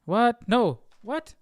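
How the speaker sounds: a sudden high startled what; surprised. Real, not acted. Checking his notes, suddenly surprised and confused. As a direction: catch yourself, genuinely thrown. Surprised at finding it was Jonah.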